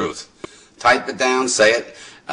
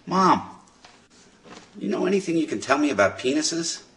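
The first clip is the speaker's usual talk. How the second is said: higher-pitched voice of a daughter; inquiring daughter; 8 semitones higher, 4 dB quieter than usual. Acted. Daughter turns to her mother, imitated inquiring daughter. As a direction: imitate a girl asking her mom. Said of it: "turns to her mother and says".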